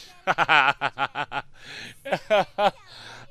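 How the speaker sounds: loud long laugh; loud, long laughing fit; loud laughter. Real, not acted. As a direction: laugh out hard.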